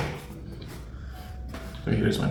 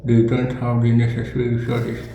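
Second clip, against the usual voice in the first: reading with a pen between teeth; cork in mouth; 4 dB louder than usual. Real, not acted. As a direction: talk around a pen held in the teeth. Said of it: "So here is my pen".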